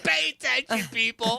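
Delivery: high, laughing mock yell; loud sharp voice. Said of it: "Why are you yelling?".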